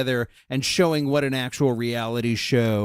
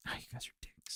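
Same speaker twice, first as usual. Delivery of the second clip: whispered mock-hurt jab; 18 dB quieter, faster than usual. Real, not acted. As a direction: hiss it under your breath, teasing. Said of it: "mock-hurt aside".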